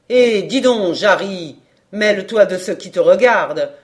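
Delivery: loud scolding call from above; shouting down. Acted. Old carpenter shouts from the skylight at Jarry, loud scolding. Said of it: "se mit à crier de la lucarne".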